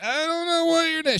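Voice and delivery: squeaky high mock voice, words mumbled; mock clueless kid. Leader mimics a kid who forgot a name, squeaky high. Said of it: "You're like".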